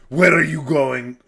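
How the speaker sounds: hammed-up Greek father accent; thick Greek accent. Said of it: "with his thick accent".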